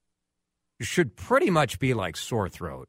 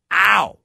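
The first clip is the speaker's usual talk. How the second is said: yelp of pain; 7 semitones higher, 9 dB louder than usual. Retold or imitated. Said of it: "I reach my hand up and go".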